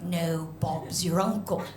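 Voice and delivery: low-key mock English accent; put-on English accent. American trying to placate border agent in a fake English accent. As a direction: play English, deliberately and drily. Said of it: "answer her in a more English way".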